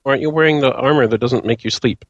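ordinary talk, nothing marked

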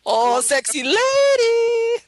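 put-on high, loud, drawn-out caricature accent; Long Duk Dong. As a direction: holler it with a long stretched last word. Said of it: "I can do a great Long Duk Dong".

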